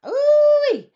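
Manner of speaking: very high, loud whoop; piercing, delighted whoop; hollering. A narrator's reading. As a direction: let out a big country holler. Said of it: "Pearline hollered".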